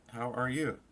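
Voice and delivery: chirpy scripted call voice; phone call roleplay. Pretend. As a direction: act out both sides of a canned call. Acting out a fake AI call.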